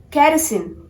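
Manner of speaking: "Kerosene" as KA-ruh-seen; "Kerosene" without the O; correct kerosene. Shown as a demonstration. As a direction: model a weak middle vowel in "Kerosene". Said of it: "how you guys have to pronounce".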